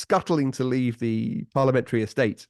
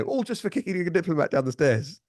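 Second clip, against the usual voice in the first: higher-pitched imitation of an aggrieved old politician; lightly voiced grumble of protest, pitched up; mock indignant quote; 7 semitones higher than usual. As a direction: play the wronged party, higher. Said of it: "he would have said".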